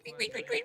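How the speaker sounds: very high squeaky couic couic; rodent squeaks. Shown as a demonstration. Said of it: "Tu dois faire couic".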